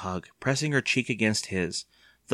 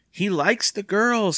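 high, slow teasing leer; 11 semitones higher, 4 dB louder, slower than usual. A narrator's reading. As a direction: draw it out suggestively. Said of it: "leering at him".

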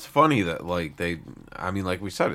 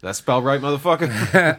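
loud, higher mock-angry chef; a hammed-up chef yelling from the kitchen; 8 semitones higher, 8 dB louder than usual. Offended chef poking his head out, mock shouting at the diners. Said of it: "he's just poking his head out".

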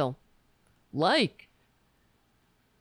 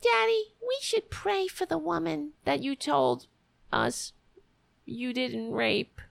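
put-on high sweet child's voice; little girl voice; 5 semitones higher than usual. Daughter pleading with her father, high and childlike. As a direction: play the innocent young daughter.

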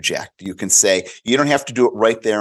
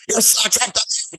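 much higher, fast parent voice; exasperated parent; 12 semitones higher, faster than usual. Retold or imitated. Parents telling kids, exasperated high voice. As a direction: squeal it like a fed-up parent. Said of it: "parents were like".

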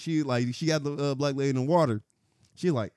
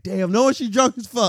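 disbelief; 8 semitones higher, 6 dB louder than usual. Retold or imitated. Own reaction retold.

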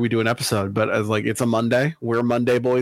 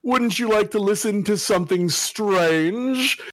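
slow, high, eerie horror host voice; 7 semitones higher, slower than usual. Acted. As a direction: draw it out like a haunted TV host. Spooky podcast intro.

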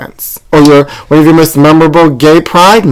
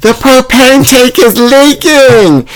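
panicked high shout, much higher; retold scream; 12 semitones higher, much slower than usual. Host retelling a stranger yelling about the leak, high panicked shout. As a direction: scream the warning from the patio. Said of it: "they scream".

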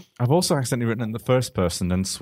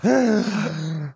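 long shocked gasp; theatrical gasp; 6 semitones higher than usual. Acted. Boxer and bee hear the butterfly, theatrical gasp. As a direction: suck in air in mock shock. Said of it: "Muhammad Ali and the bee both gasped".

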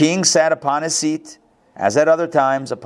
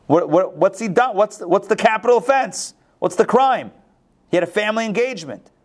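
high-pitched, insistent pleading of a case; incredulous protest; 6 semitones higher, 4 dB louder than usual. Acted. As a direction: argue back in disbelief, voice pitched up. Voicing Jonathan's protest to father.

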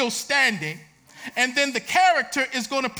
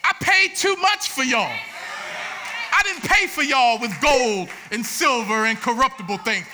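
loud, higher declaration as the Lord; a booming Lord, louder and higher; voicing God preaching; 4 semitones higher, 4 dB louder than usual. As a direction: proclaim it as God speaking, with fire. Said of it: "And so the Lord is saying".

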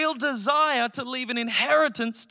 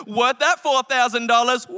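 loud whooping millennial, half joking; a mock party cheer; mock excited cheer; 6 dB louder than usual. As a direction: cheer it like a spoiled kid on holiday.

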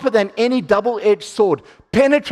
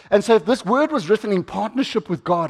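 normal talking voice

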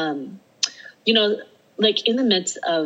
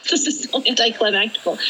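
mock dismay; 6 semitones higher than usual. Laughing repeat of the thought.

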